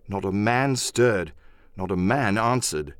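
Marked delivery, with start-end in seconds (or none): none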